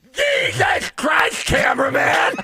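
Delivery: high, slow, raspy startled yell; shouted coach impression; Lou Holtz yelling. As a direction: bark it like a startled old coach.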